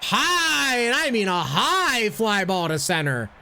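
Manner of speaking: baseball announcer play-by-play. Acted. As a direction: call the game like a broadcaster. Plays announcer in game.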